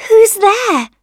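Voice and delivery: high, loud little questioning voice; rosebud calling out. A narrator's reading. Rosebud to tapper on window, high loud question. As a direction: call it out as a small rosebud. Rosebud asks who is there.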